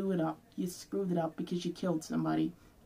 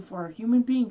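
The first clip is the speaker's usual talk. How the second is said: louder, higher flare of anger; angry burst; 4 semitones higher, 9 dB louder than usual. Real, not acted. Calling the cop a monster, angry burst. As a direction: spit it out in a flash of fury. Raging rant at the police.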